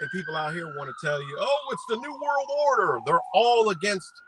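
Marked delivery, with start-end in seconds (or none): 1.4-4.1 high-pitched mocking alarmist voice. Vlogger imitating people warning of a New World Order, mock alarm. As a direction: mimic a panicky conspiracy believer, pitched way up.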